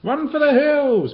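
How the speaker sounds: high, loud and slow panicked bellow. Acted. Miss Moo Hoo warning the animals, bellowing. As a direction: yell it to send everyone running. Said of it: "bellowed Miss Moo Hoo".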